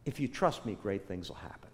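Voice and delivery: soft, low promise; hushed, steady assurance; inner voice of God. Acted. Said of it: "He just said".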